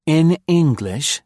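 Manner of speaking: slow, high, resigned woman. A narrator's reading. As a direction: sigh it as weary interpreter. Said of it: "said Maud resignedly".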